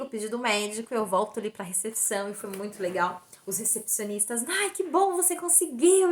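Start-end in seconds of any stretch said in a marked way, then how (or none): 4.5-6.1 high, excited congratulation; delighted cheering. Retold or imitated. Receptionists congratulating the patient on getting the request, delighted. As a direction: gush with relief for her.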